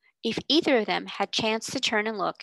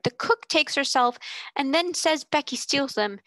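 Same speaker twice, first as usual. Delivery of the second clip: passionate outburst; 6 semitones higher than usual. Acted.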